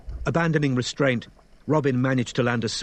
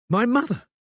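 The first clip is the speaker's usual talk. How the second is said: shocked; 13 semitones higher, 4 dB louder than usual. Acted. Robin shocked mid-fight.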